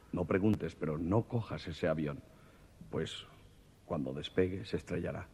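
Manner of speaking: a mysterious voice warns him off the train; ominous voice. Acted. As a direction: drop the voice like a ghostly warning.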